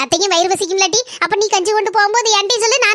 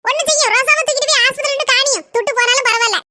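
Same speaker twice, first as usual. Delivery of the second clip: higher-voiced worried village wife scolding her husband; 5 semitones higher than usual. Village wife worrying over the sick cow and scolding her husband. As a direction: play the fretting wife, a little higher.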